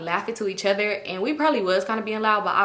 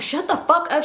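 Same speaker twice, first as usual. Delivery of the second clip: higher-pitched mock scolding; mad; 6 semitones higher than usual. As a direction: imitate an annoyed friend telling them off. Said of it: "She's so mad".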